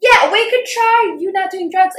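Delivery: loud, high, mock-obvious comeback; sarcastic retort. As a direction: act out a heated, sarcastic suggestion. Acted sarcastic retort in quarrel.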